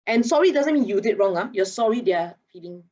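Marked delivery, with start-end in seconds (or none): none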